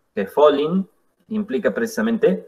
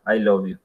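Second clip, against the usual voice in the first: I LOVE YOU low and level; neutral tone; 5 semitones lower than usual. Shown as a demonstration. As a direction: keep I LOVE YOU from going up or down, unenthusiastic. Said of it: "ni sube ni baja". (I LOVE YOU sin ganas, sin subir ni bajar)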